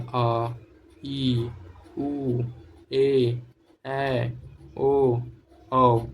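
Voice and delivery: आ, ई, ऊ, ए, ऐ, ओ, औ each stretched long and loud; drawn-out long vowels. Shown as a demonstration. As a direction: hold every vowel आ ई ऊ ए ऐ ओ औ for double length. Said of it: "लघु स्वर से दो गुना समय". (आ ई ऊ ए ऐ ओ औ को खींचकर लंबा बोलना)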